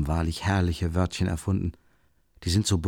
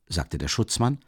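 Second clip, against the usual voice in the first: ordinary delivery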